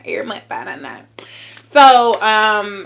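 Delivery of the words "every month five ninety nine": fast teasing whisper. Acted. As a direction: whisper the price quickly.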